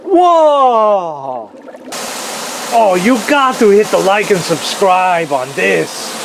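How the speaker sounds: high, excited mock Russian accent; hammed-up Russian-accented awe. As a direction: gush like an amazed Russian video host, Russian accent. Reprises the Russian host impression.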